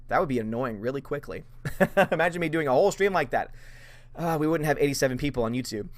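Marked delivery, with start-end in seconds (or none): none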